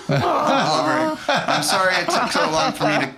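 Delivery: high, laughing, slowed words; laughing through tears. Real, not acted. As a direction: laugh while the feeling breaks through. Moved while retelling the letter scene.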